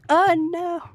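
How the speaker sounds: loud high alarmed yelp; panicked cry. Real, not acted. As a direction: cry out as you slip. Falling in obstacle game.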